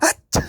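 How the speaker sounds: loud comic sneeze. Acted. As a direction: burst into a huge sneeze. Sneeze after the trick.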